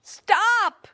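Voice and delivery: high, loud panicked yell; desperate shout. A narrator's reading. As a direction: scream at the pot to stop. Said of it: "yelled Big Anthony".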